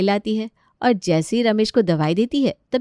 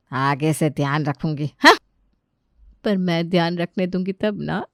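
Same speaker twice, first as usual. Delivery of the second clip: lower, sly mocking repeat of आगे से ध्यान रखूंगी; mocking echo; 3 semitones lower than usual. [name] mocking Preeti's promise behind her back, sly low sneer. [name] mocks the apology aside.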